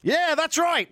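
very high, loud yelled agreement; high, loud fawning cheer; mock cheering follower. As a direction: whoop like a mindless supporter. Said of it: "some people will shout".

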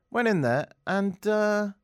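ordinary delivery

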